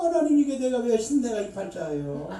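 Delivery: usual speaking voice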